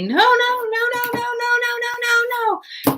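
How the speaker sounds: very high-pitched frantic string of no's; high, panicky mock scream. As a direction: shriek no over and over, imitating a hysterical stranger. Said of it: "started screaming at me".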